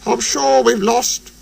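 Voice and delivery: high, dismayed little voice; groaning. A narrator's reading. Percy sure he has lost, high groan. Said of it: "groaned Percy".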